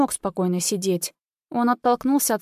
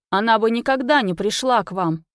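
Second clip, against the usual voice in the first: ordinary delivery, nothing marked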